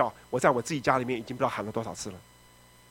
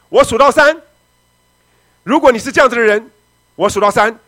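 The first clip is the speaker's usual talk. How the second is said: loud preacher's challenge from the pulpit; calling out loud; 9 semitones higher, 13 dB louder than usual. Retold or imitated. Preacher challenging the crowd to come forward, loud call. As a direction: boom it out like a revivalist counting down.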